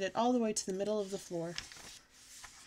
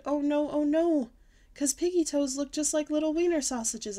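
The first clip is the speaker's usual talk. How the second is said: scared; 7 semitones higher, 6 dB louder than usual. Acted. Child frightened of monster.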